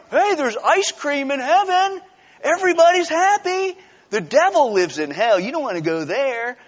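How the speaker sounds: high, peppy, over-eager selling; mock evangelist pitch. Evangelist luring kids with ice cream, mock enthusiasm. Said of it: "that's how we sell".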